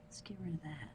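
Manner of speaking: whisper. Real, not acted.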